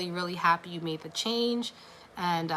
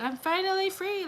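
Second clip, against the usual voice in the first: much higher, a delighted cry of freedom; joyful release; 12 semitones higher than usual. Pretend. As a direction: soar up on the word free. Imagined client feeling liberated.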